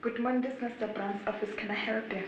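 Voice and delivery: ordinary delivery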